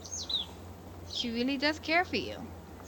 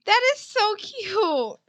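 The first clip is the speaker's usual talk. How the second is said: high, loud and slow; gushing; 9 semitones higher, 7 dB louder, much slower than usual. Real, not acted. Player gushing over the game's ending, excited. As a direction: stretch it out, overjoyed.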